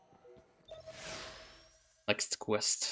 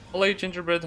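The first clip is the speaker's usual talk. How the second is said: bright, higher and louder hello to a pet; cheerful greeting; 9 semitones higher, 6 dB louder than usual. Real, not acted.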